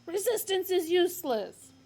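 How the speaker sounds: loud mechanical shout; guard shouting. Acted. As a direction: shout it by rote. Guard repeats his shout.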